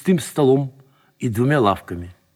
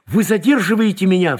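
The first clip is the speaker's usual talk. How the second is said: higher, alarmed exclamation; crying out; 6 semitones higher, 4 dB louder than usual. A narrator's reading. Detained man realizes and protests to lieutenant, crying out. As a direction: cry out in protest. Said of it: "вскрикнул он".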